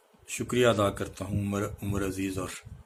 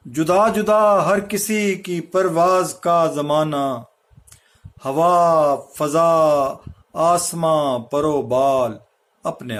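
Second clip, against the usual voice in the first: louder, higher, measured mushaira delivery; Urdu ghazal recitation; 7 semitones higher, 9 dB louder, slower than usual. Acted. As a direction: recite couplets to a gathering, drawing out the phrases. Recites own ghazal couplets to gathering.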